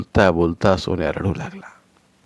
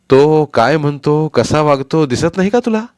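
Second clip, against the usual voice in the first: husband snapping back in a quarrel; angry retort; 7 semitones higher, 8 dB louder than usual. Acted. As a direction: bite back at the wife.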